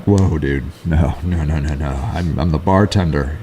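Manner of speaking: retelling how he turned down the job, voice dropped low; reluctant refusal. Retold or imitated. Bartender brushing off a customer's request, low mock refusal.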